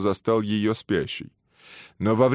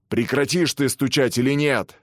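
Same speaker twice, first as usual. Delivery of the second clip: louder, higher warden's roar; thundering; 6 semitones higher, 3 dB louder than usual. Acted. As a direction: bellow the demand at him. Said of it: "загремел он".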